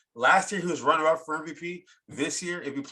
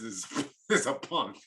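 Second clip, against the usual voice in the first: laughing; 2 semitones lower than usual. Real, not acted. Cracks up insulting the team executive.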